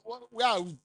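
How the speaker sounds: normal talking voice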